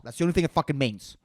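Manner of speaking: rattled off fast; rapid, matter-of-fact brush-off; quick dismissal. Real, not acted. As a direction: toss the whole line off in one rush. Quick first take, then slow repeat.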